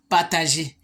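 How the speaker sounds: ordinary delivery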